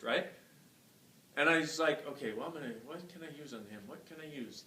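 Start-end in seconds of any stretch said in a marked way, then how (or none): 2.1-4.6 soft murmured self-question; muttering it under the breath while thinking; inner murmur. Retold or imitated. Chiropractor asks himself quietly over the patient, murmured.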